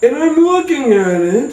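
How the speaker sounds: ordinary delivery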